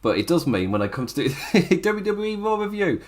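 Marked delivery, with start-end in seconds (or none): none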